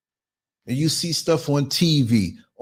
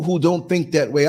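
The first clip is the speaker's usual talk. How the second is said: normal talking voice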